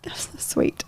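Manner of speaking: soft moved whisper; whispered thanks, moved; touched whisper. Real, not acted. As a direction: breathe it, touched. Touched reply to loving words.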